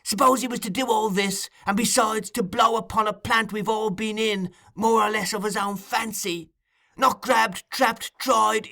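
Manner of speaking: put-on high-pitched, intense old schemer working up to fury. A narrator's reading. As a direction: build an angry case in a high voice. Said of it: "his eyes flashing with rage".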